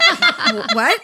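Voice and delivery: high squeaky what with a laugh; stunned disbelief with a laugh; shocked. Retold or imitated. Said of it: "And I went".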